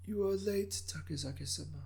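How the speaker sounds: put-on soft, high, raspy elderly voice; an old governor gently scolding a late guest; raspy ancient governor. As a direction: rasp it out like a frail old lord. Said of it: "intoned in a raspy voice".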